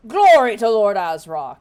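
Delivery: loud booming hobgoblin cry; shouted salute. Acted. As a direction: bellow it like a soldier.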